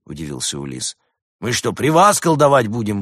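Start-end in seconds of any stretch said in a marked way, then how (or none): 1.4-3.0 indignant. A narrator's reading. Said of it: "возмутился левый демон".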